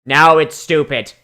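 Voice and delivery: ordinary delivery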